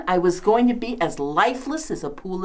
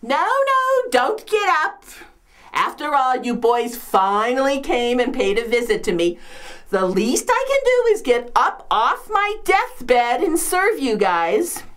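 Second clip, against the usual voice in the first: hammed-up martyr; 2 semitones higher than usual. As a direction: insist on serving as the suffering hostess. Fussing over guests from her deathbed.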